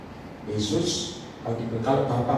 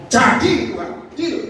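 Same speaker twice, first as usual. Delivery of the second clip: believer's declaration; 10 semitones higher, 6 dB louder, slower than usual. Retold or imitated. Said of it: "Kalau kamu ngomong".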